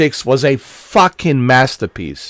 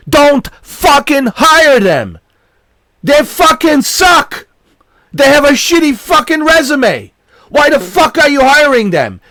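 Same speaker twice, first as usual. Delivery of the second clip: furious yelling; 11 semitones higher, 5 dB louder than usual. Real, not acted. Gamer ranting at Sony about bad hires, furious shout. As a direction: scream it out in real fury at them. Ranting at Sony's hiring choices.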